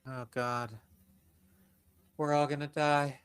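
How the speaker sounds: hushed mock despair; mock doom. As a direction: whisper it like certain doom.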